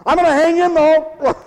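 mock brave laugh while talking.

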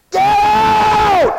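very high, long-drawn bellow; deafening shout. Retold or imitated. Told to speak up, answers with a huge drawn-out shout. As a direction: yell it as loud as you can. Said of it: "Man, why don't you speak up?".

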